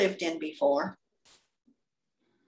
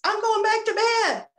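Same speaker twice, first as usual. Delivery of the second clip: high-pitched acted exasperation; fed-up refusal; 13 semitones higher, 4 dB louder than usual. Retold refusal of God's demand.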